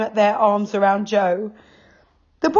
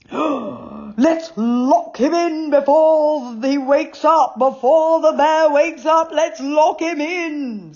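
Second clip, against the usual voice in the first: higher, slow, urgent bear voice; urgent father bear; 10 semitones higher, slower than usual. A narrator's reading. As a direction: hurry them to lock the door.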